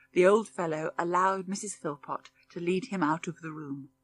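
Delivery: plain reading voice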